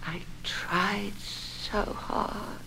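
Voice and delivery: barely above a breath, very slow; drawn out and fading away. Acted. Wife to her husband, very slow and fading.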